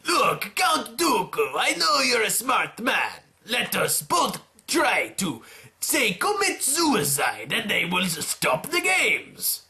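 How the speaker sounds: slow, drawn-out scheming plea in a put-on voice; slow sly coaxing voice; voiced Watto. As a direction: coax him slowly like a sly schemer. Watto voiced pitching suicide.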